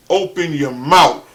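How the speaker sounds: loud, slow command; each word loud and drawn out; imitating an impatient dad. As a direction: imitate a dad demanding you speak up. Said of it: "I sounded real low".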